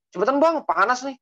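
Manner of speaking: high, loud mock hurrying; rude, impatient customer. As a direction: rush the driver impatiently. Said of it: "kayak sinetron S C T V banget".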